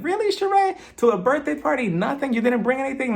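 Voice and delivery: high-pitched, scandalized questioning; incredulous. Retold or imitated. Host calling out a guest who came giftless, incredulous. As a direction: call her out in high disbelief. Mock disbelief at no gift.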